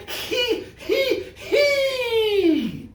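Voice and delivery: very high squealed fake giggle; silly hee-hee. Shown as a demonstration. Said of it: "Everyone go".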